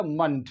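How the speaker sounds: मंथ said as short "munt"; schwa ending. Shown as a demonstration. Teacher explains the ending, schwa "munt". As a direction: reduce the vowel in मंथ to "uh", ending in T. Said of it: "यहाँ पे पढ़ा जाएगा".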